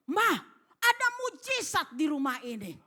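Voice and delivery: mock-excited husband breaking big news; retold excited announcement. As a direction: announce a miracle loudly, as in a retelling. Husband excitedly tells wife of miracle.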